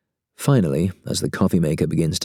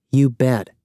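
tight, higher-pitched reply; 7 semitones higher, 3 dB louder than usual. A narrator's reading. Woman brushing off an apology, tight. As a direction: say it tensely, pitched up. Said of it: "she says tightly".

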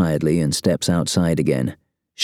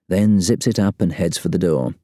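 usual speaking voice

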